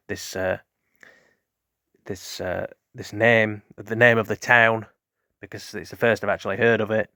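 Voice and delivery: ordinary talk, nothing marked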